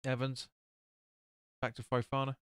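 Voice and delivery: ordinary talk, nothing marked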